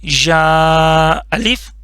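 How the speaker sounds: drawn-out syllable reading, slow and clear; slow teacher demo. Shown as a demonstration. Teacher reciting the letter for students, slow and drawn out. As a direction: model the letter name slowly for the class. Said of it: "ٹھیک ہے".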